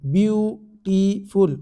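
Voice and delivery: BYU-TI-FUL in three separate beats, drawn out; syllable by syllable. Shown as a demonstration. Teacher counting vowel sounds for students, syllable by syllable. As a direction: break beautiful slowly into three syllables. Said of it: "तीन vowel sound आ रहा है".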